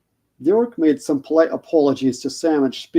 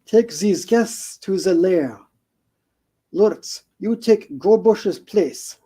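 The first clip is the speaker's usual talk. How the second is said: lightly put-on accent, a touch higher; Shelob's vague accent; 5 semitones higher, slower than usual. Shelob greets Frodo in a lightly accented voice. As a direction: purr the greeting in a hint of a foreign accent. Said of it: "no idea what the accent was".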